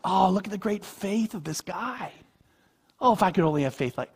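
high-pitched mock awe; mock admiration. Preacher mimicking believers admiring the thief's faith, mock gushing. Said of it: "what we make this about is".

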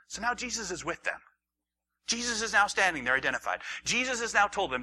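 ordinary talk, nothing marked

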